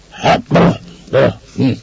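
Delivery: put-on drunken hemming and hawing; throat-clearing croak. A narrator's reading. Drunk Ivan dangling in the master's grip, croaking. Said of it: "krákogott Iván".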